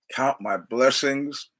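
drawn out slowly, savoring each word; slow repeat. Shown as a demonstration. Host repeating the movie line slowly to savor it. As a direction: linger over the words. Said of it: "should instead count my blessings".

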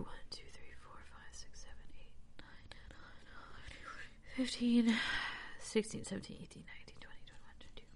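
whispered, rapid tally to oneself; counting under the breath. Real, not acted. Reader privately counting the psalm's parts, whispered. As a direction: count in a barely audible whisper.

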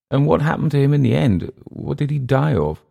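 high, quick inquiring voice; anxious questioning Englishman. Acted. Englishman asking his host about a man's death, high and anxious. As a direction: press the question, pitched well up.

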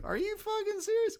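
high-pitched, incredulous question; retold disbelief. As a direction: act out your astonishment at the near fall. Retelling disbelief at a false finish.

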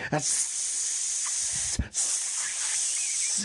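long voiceless creature hiss; Gollum's long hiss. A narrator's reading. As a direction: hiss through the teeth, drawn out. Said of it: "hissed Gollum".

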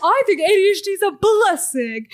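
mocking the influencer. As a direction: ham up a dismissive bully. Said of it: "He's like".

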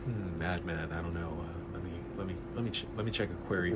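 very soft, low, mellow murmur; smooth jazzy. Retold or imitated. Comic shop clerk looks up a title, smooth and jazzy. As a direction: think aloud like a late-night jazz host. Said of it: "he talked very smooth jazzy".

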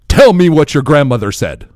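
loud, insistent imitated African accent; thick African accent. Drunk African man pressing a new friend, loud thick African accent. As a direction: demand it in a mock African accent. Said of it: "he was drunk and his accent was very thick".

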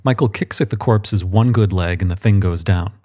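ordinary delivery, nothing marked

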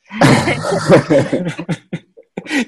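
hearty laugh. Real, not acted. Host laughing at his Santa Claus joke, hearty laugh. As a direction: laugh out loud at your own joke.

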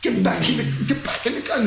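high urgent call, half-shouted; retold dad yelling at his girl; urgent call to daughter. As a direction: order her back to the car, fast. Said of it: "and I'm like".

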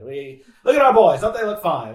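loud, high Golden Age Hollywood announcer; booming old-time studio announcer. Retold or imitated. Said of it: "Golden Age Hollywood".

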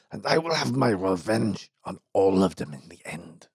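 a lightly voiced frail, bitter old man; ailing employer muttering darkly of revenge; sickly old mine owner. Dying mine owner speaks.

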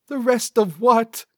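high-pitched baffled sidekick; high-voiced questioning. A narrator's reading. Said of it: "Cecil asked".

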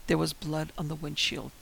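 usual speaking voice

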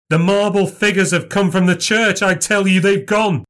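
high-pitched, agitated insistence; frantic gasping. A narrator's reading. Frightened man telling the doctor what he saw, frantic. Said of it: "I gasped".

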